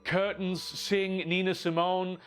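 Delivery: ordinary delivery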